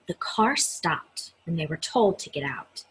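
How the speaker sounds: ordinary delivery, nothing marked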